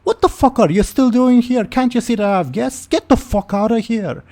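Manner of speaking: high, loud screeching tirade; furious mother character shouting; irate woman. Acted. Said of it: "standing there bewildered and says".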